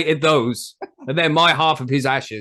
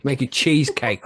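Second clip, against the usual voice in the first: ordinary talk, nothing marked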